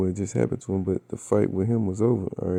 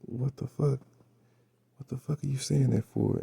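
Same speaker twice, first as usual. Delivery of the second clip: startled; 4 semitones higher, 3 dB quieter than usual. Retold or imitated. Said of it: "and he was like".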